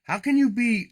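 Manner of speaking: ordinary delivery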